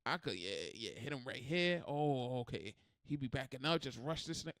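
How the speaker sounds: lightly voiced plotting, under the breath; imitating fresh fighter's thoughts. Voicing the fresh fighter sizing him up, hushed.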